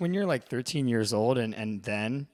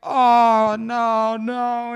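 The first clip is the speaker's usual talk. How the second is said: put-on old lady's loud cry of despair; 12 semitones higher, 14 dB louder, much slower than usual. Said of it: "And she's like".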